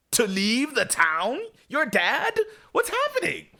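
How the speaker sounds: high, climbing incredulous questions; comic disbelief, pitch shooting up at the end; baffled disbelief. Acted. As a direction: question it in escalating bewilderment. Host baffled by the plot.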